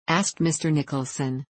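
plain reading voice

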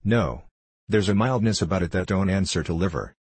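plain reading voice

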